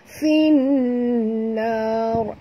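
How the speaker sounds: chanted Finnar with held nun; Quran recitation. Shown as a demonstration. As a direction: recite Finnar with nasal hum. Recites verse for class. (bacaan Finnar berdengung)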